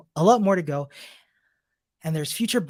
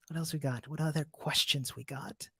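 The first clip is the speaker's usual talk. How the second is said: much softer, half to herself; muttered to self; 4 semitones lower, 11 dB quieter than usual. Real, not acted. Host murmuring to herself while scanning chat questions, much softer.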